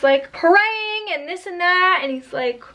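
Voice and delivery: ordinary talk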